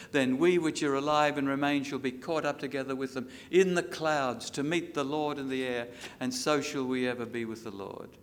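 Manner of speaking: rings out loud over the congregation; loud scripture proclamation. Acted. Preacher proclaiming the rapture verse to the church, loud. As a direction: declare it like a trumpet call. Loud reading, later reread quietly.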